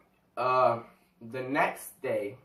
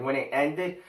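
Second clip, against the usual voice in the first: ordinary talk, nothing marked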